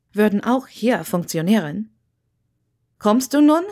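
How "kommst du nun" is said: higher, louder call that cuts in; cutting call. A narrator's reading. Wendeline calls Hermine out of her thoughts, loud. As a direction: cut through her thoughts. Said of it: "durchschnitt Wendelines Stimme".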